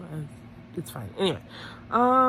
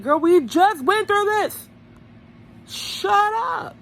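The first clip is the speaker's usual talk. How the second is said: loud, high-pitched telling-off; exasperated shout; 11 semitones higher, 4 dB louder, slower than usual. Acted. Viewer telling a contestant off, loud exasperated shout. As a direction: yell it slowly in exasperation. Acting exasperated reply to Teresa.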